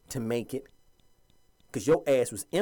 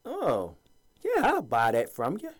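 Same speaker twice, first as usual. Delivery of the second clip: usual speaking voice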